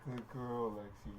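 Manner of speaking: gentle, quiet praise for a good dog; soft dog praise. Real, not acted. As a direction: praise softly, low key. Praising dogs after sitting.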